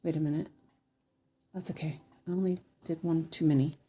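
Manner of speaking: normal talking voice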